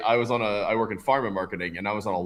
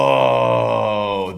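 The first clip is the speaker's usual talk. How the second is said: long, loud drawn-out "oh" of discovery; dawning realization; 7 dB louder than usual. Retold or imitated. Man retells hearing his name land at the bar, dawning realization. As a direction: stretch it out as the idea clicks.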